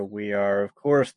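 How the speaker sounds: normal talking voice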